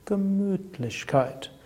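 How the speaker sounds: slow, soft cosy word; slow soothing Gemütlichkeit. Shown as a demonstration. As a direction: let it melt out slowly. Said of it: "Ab und zu mal sage dir".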